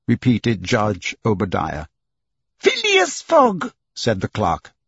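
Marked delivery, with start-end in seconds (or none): 2.6-3.7 very high, drawn-out name call; clerk's roll call. Acted. Clerk calls the accused, high and drawn out. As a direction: sing out the name high and slow.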